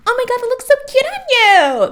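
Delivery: very high, loud mock-gushing shop girl; gushing sales associate. Sales associate to a teen customer, high loud mock gush. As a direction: gush like a teen clothing store clerk.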